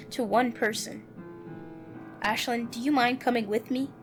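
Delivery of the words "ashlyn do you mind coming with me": ordinary talk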